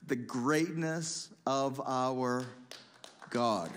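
drawn-out greatness, God dropped low; slow right down and land it; the preacher's emphatic closing line; slow, emphatic close. Real, not acted. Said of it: "I'm hoping you're gonna get this".

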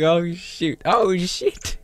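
high, loud startled cries; retold fright. Gamer retelling his scare to viewers. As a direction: replay the jump scare. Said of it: "I was like".

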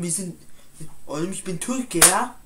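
ordinary talk, nothing marked

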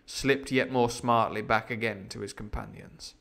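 usual speaking voice

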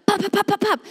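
rapid high popping gunfire noises; imitated gunshots. Woman expecting shots through the window, imitated gunfire. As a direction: fire off pops like shots.

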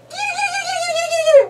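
very high sing-song call; high kitty call. Real, not acted. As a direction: squeal it like calling a cat. Calling to babies.

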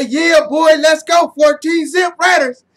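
loud, high game-day yell; cheering shout. Real, not acted. Fan at home cheering the Raiders with a loud yell. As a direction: hype the team up with a shout.

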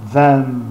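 Them ending on closed lips, M. Shown as a demonstration. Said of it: "e o M de certa forma".

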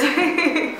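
short awkward giggle; awkward laugh. Real, not acted. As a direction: laugh awkwardly, a little high. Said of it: "That's my awkward laugh".

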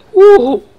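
very high, piercing woo; loud whoop. Real, not acted. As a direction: let out a sudden celebratory whoop. Celebrating a play.

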